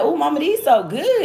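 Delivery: high-pitched imitation of a delighted kid; excited daughter. Daughter tells her mama the bombers are good, high child imitation. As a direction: mimic your daughter's pleased, high little voice. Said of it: "So she was just like".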